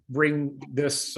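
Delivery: ordinary delivery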